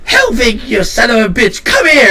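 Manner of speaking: loud, high-pitched hollering; exuberant greeting shout. Acted. Shopkeeper jumps out to greet an old friend, exuberant yell. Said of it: "He goes".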